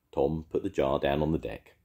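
ordinary delivery, nothing marked

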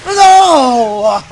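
shout. Real, not acted. Losing in game.

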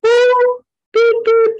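high-pitched alarm beeps; body beeping. Retold or imitated. The body flaring up, beeping an alarm. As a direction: beep like a little warning alarm. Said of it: "it will flare up and say".

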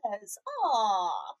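slow, mock-mournful sigh of the crew; drawn-out fake sympathy; mock sad aw. The whole ship mourning Cortez, slow mock sorrow.

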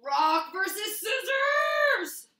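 slow, high, loud match call; announcer shout. Acted. Storyteller calls Rock against Scissors, slow booming shout. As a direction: stretch it out like a ring announcer.

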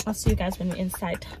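normal talking voice

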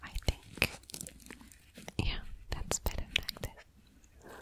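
very soft, barely voiced whisper; murmuring to self. Medic working on a patient's wounds, murmuring to self. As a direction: think aloud under your breath while working.

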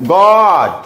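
teacher modelling the open vowel in গড; short O in গড. Shown as a demonstration. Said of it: "ও এর উচ্চারণ হচ্ছে অ".

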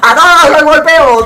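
loud fired-up shout. Real, not acted. Gamer yelling at his useless teammates, loud shout. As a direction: yell it like a battle cry.

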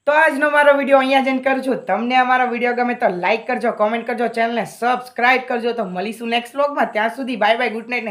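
cheery goodbye to subscribers after the rant; upbeat vlog outro. Real, not acted. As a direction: drop the anger and wrap up the video like a host.

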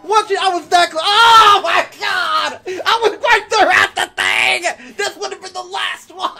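high, loud wail of disbelief; anguished shout. Real, not acted. Player who died right at the finish, anguished shout. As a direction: scream in agony at the near miss. Dies just short of winning.

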